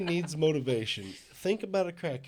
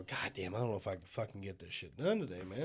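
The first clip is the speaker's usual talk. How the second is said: weary groan; 9 semitones lower, 12 dB quieter, faster than usual. Retold or imitated. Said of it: "and go".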